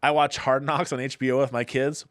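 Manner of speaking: ordinary delivery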